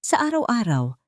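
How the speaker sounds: plain reading voice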